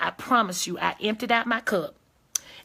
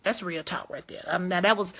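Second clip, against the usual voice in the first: ordinary delivery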